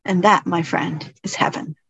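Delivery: low, tender, hushed ending; gentle monk. Acted. Monk shows the humbled samurai heaven, low and gentle.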